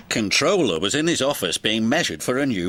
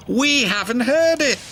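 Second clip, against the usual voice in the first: much higher sing-song plea; chiming; 9 semitones higher, 4 dB louder than usual. Acted. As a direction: chime it like eager twins. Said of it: "they chimed".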